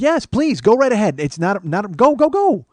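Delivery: high, loud, hurried-sounding encouragement; acted eager go-ahead; insistent urging. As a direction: urge them on emphatically, pitch jumping up.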